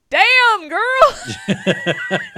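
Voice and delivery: loud, high-pitched exclamation breaking into a laugh; impressed whoop. Retold or imitated. Reacting to kiddo standing up to grandmother, impressed whoop. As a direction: belt it out in amazed admiration. Retold stunned cheer at kiddo.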